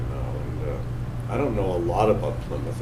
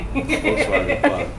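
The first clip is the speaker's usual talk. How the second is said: laughing out the punchline; high laughing burst; 7 dB louder, slower than usual. Real, not acted. Laughing joke about the car.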